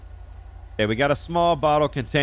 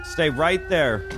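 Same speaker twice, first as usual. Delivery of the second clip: high, stern warnings; firm commands; 5 semitones higher than usual. Real, not acted. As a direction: threaten the suspect into staying put. Ordering suspect and calming dog.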